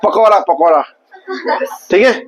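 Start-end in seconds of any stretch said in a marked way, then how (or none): 0.0-0.8 पकौड़ा with a flat English-style r, said twice; mock English accent on पकौड़ा; anglicized pronunciation. Shown as a demonstration. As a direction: say पकौड़ा as an English speaker would. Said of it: "English में बोलते हैं पकौड़ा".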